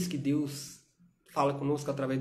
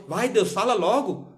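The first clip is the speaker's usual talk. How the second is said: impatient plea; 6 semitones higher, 8 dB louder than usual. Acted. Mock impatient believer's plea.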